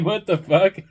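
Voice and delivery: laughing through the words in real disbelief. Real, not acted. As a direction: let the laugh break into the words. Laughing at the absurd caller.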